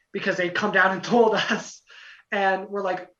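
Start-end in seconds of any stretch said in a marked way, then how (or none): none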